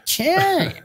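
squeaky high incredulous "Champ"; laughing high-pitched "Champ"; mocking Eddie. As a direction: shoot way up on "Champ" in mock outrage. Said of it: "He's gone".

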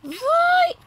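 sudden high yell; excited shout. Real, not acted. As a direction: cheer it on loudly. Urging character on in game.

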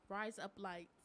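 low, faked Australian; Australian accent. Shown as a demonstration. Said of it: "say with an Australian".

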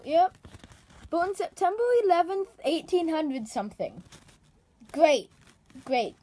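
normal talking voice

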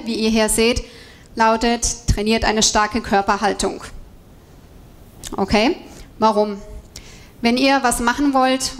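ordinary talk

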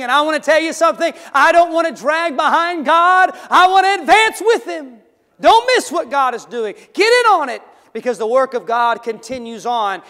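high, loud, shouted exhortation; fiery revival preacher; preaching at full force. Acted. Preaching climax.

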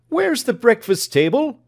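high, loud impatient question; doctor demanding breakfast. Acted. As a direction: call out for the missing table. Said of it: "said the doctor".

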